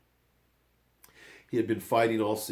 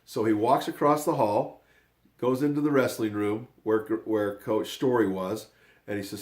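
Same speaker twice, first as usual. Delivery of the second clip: ordinary talk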